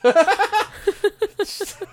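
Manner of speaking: very high helpless laughter; losing it at the joke. Real, not acted. Cracking up.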